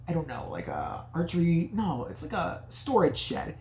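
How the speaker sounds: normal talking voice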